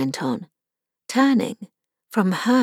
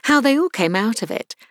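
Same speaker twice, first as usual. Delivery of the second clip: usual speaking voice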